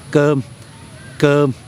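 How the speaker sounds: cơm with a C between K and G and an "er" vowel; gentle C. Shown as a demonstration. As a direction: say cơm slowly as "germ", gently. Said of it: "it's a gentle C".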